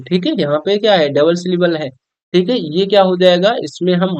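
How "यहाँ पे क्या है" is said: ordinary delivery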